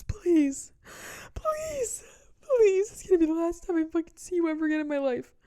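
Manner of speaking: high, loud, sobbing beg; wailing plea. Retold or imitated. The ex begging for one last hug, wailing. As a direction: wail the pleading like a child.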